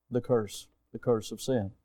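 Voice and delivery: soft, low and solemn; quiet, low sermon emphasis; grave hush. Acted. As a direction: drop to a hush for the weight of sin. Preacher's solemn point.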